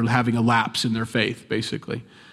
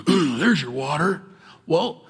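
sarcastic; 4 semitones higher, slower than usual. Retold or imitated. Moses mocked striking the rock.

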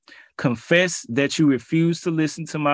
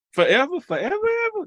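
forever leaps much higher, incredulous; faster than usual. Real, not acted. Preacher asking the congregation about God's anger, incredulous high rise. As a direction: stretch forever up in disbelief.

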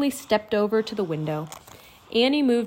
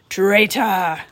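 loud villainous cry of accusation; angry screech; 8 dB louder than usual. A narrator's reading. Raven King turning on Rourke, angry screech. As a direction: screech it out in fury. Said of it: "screeched at Rourke".

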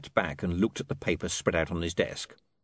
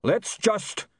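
high yell that breaks off; raised, cut-off cry; 12 semitones higher than usual. Acted. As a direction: start a rallying yell. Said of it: "he began to yell".

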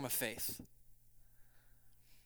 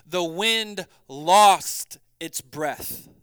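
slow scripture reading; 11 dB louder, much slower than usual. Acted.

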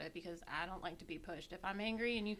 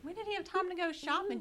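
mock surprised, pitched well up; disbelieving mother, high and quick; surprised question; 10 semitones higher, 5 dB louder than usual. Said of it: "I'm like".

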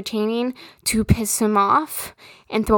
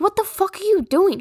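exasperated outburst; 8 semitones higher, faster than usual. Retold or imitated. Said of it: "I asked him".

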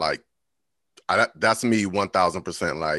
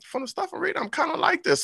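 high amused inner voice. Retold or imitated. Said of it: "I'm like".

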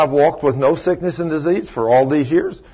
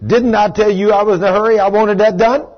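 angry boss scolding, higher and fast; raised voice; 9 semitones higher, 4 dB louder than usual. Retold or imitated. Boss dressing down a young employee over an unfinished task, voice raised. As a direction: re-enact a boss losing patience with an employee. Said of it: "And I'm raising my voice".

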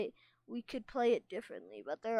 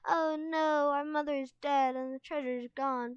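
high, loud cry easing off; hammed-up grief; mock anguish; 5 semitones higher, 4 dB louder than usual. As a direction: wail it theatrically like a child playing bereaved. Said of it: "let out a cry of anguish".

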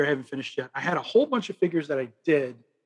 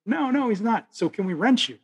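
higher, louder earnest plea; kid voice; 8 semitones higher, 4 dB louder than usual. Retold or imitated. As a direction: ask like an eager kid. Kid's line acted in retelling.

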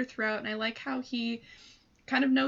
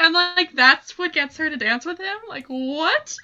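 incredulous; 6 semitones higher, 10 dB louder than usual. Real, not acted. Disbelief at movie line.

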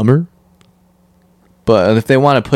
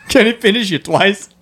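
higher-pitched mimicry of a Boston accent; 9 semitones higher than usual. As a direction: echo it back in exaggerated Bostonian. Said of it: "with a Boston accent".

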